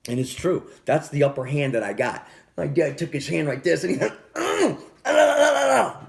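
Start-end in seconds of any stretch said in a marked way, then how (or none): 4.3-6.1 high, loud mock sputter before a slap; imitated angry stammer. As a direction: mimic dad sputtering wordlessly, getting louder. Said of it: "just like that".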